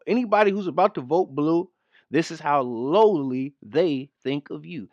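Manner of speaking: ordinary delivery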